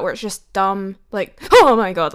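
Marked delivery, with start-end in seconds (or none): 1.5-2.1 mock jump-scare shriek. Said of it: "like that".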